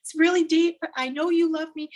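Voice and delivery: high-pitched, urgent begging; frantic pleading donkey. Retold or imitated. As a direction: plead like a trapped donkey going crazy. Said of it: "you know, going crazy".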